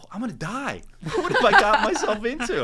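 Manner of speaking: high, loud, laughing dread; laughing panic. Retold or imitated. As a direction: laugh through mock terror. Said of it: "I'm like".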